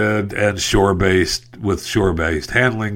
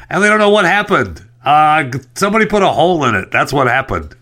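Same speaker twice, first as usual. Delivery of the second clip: much higher, louder sarcastic blurt; sarcastic outburst; 6 semitones higher, 3 dB louder than usual. Real, not acted. Host mocking officials who don't know why it sank, sarcastic. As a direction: throw the answer at them, incredulous.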